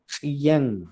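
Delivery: chillán with ch then a y sound, chi-yan; slow chi-llán as the model; correct chillán. Shown as a demonstration. Teacher showing the class how chillán sounds. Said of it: "Entonces sigue la yi".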